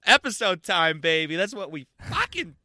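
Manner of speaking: loud, hyped-up outburst; excited shout. Retold or imitated. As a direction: yell it like the best news ever. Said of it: "And I was like".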